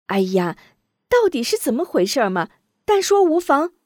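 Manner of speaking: put-on impatient young lady urging; urging daughter. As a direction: push the maid to speak. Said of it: "张秀秀一劲儿催促".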